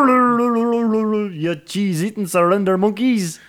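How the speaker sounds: high, drawn-out mad Scottish impression; hammed-up cartoon Scotsman, trilled greeting; Groundskeeper Wally. Host slips into a cartoon impression for co-hosts, mad Scottish voice. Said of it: "Groundskeeper Wally".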